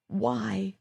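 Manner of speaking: quiet, cracking plea; voice breaking. Acted. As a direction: let the word crack on the edge of tears. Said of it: "my voice breaking".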